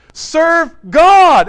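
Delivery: commanding urge. Acted. Preacher voicing Joshua's urging.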